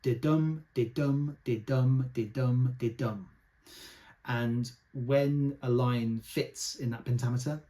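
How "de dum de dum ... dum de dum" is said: de-dum chanted in even iambic beats, stress on dum; rhythmic demo chant. Shown as a demonstration. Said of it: "it sounds a bit like this".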